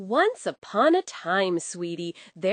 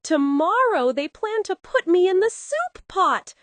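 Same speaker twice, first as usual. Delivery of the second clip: higher, louder wailing rooster; hammed-up rooster lament; dramatic rooster; 9 semitones higher, 5 dB louder than usual. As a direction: ham up the doom. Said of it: "cried Rooster dramatically".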